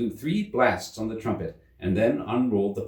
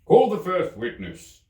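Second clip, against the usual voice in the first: the king; 10 semitones higher, 5 dB louder, slower than usual. A narrator's reading. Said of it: "said the king".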